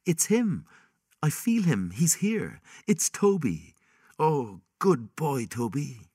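put-on high, bright child's voice; a child thrilled to feel her dog; excited child voice. Child senses the lost dog.

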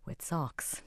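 soft, dry, deadpan delivery; deadpan aside. Acted. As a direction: drop it quietly for the joke. Presenter's ironic punchline.

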